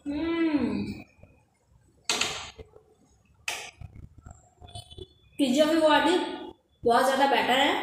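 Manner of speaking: chewing while talking; speaking between bites; mouth full of pizza. Real, not acted. Seen 0: Bites pizza at -5, cheeks look full while speaking, slice in hand.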